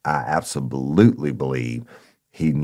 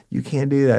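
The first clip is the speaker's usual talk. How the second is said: high yelped protest; 12 semitones higher, 4 dB louder than usual. Retold or imitated. Informant protesting arrest, high.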